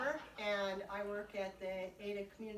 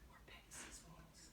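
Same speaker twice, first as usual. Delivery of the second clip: whispered Pegasus wings; whispered aside; 14 dB quieter than usual. Real, not acted. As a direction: drop to a secret whisper. Listing wing ideas softly.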